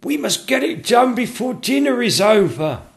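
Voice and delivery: put-on high, loud, urgent old courtier; anxious courtier. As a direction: hurry everyone along, fretting. Anxious chamberlain urging.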